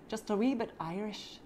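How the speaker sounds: playful put-on Irish lilt; light amateur Irish brogue; Irish accent. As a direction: repeat it in a mock Irish accent. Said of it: "that was my best Irish accent".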